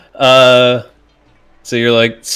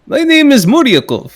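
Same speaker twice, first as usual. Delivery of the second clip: high, louder put-on foreign accent announcing his name; Russian-ish accent; 14 semitones higher, 6 dB louder than usual. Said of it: "walking the line with accents".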